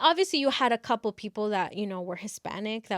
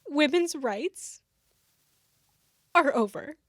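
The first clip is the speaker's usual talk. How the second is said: put-on earnest girl; 6 semitones higher, 3 dB louder than usual. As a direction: play it up as an over-earnest, fearful student. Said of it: "a girl's like".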